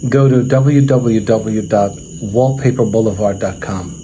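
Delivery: loud, brisk commercial pitch; sponsor ad read. Acted. Host pitching a sponsor to his viewers, loud ad-read voice. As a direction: sell the wallpaper store to viewers, punchy.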